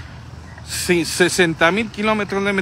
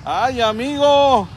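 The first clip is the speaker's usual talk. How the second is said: delighted; 5 semitones higher, much slower than usual. Real, not acted. Delighted at gift of tortas.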